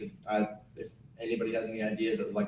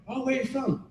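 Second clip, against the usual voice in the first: higher-pitched imitation of girls at a bar; voicing curious girls; 12 semitones higher, 3 dB quieter than usual. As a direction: play the girls, pitched well up. Retold girls questioning the Irishman.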